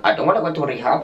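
put-on playful sung line in an Indian accent. Vlogger repeating the song after noticing it, Indian accent. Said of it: "Pourquoi j'ai pris un accent indien?".

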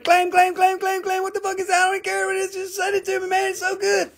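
high, excited mock buyer voices; high frantic mock clamor; frenzied buyers. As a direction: squeal like greedy collectors. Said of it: "Everybody was like".

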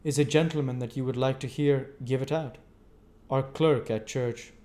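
a hint of easygoing Joe; Joe the blacksmith. A narrator's reading. Joe introduces Wopsle.

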